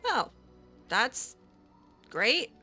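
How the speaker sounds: normal talking voice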